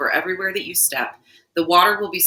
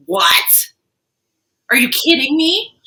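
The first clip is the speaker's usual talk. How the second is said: mock disbelief; 6 semitones higher, 6 dB louder, slower than usual. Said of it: "And you're like".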